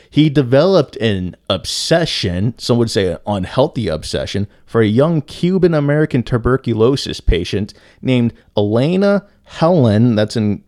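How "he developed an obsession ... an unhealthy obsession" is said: ordinary talk, nothing marked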